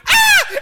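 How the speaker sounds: sudden high piercing shriek of cold pain; imitated shriek. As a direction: shriek as bare feet hit icy snow. Wife's feet hit the snow.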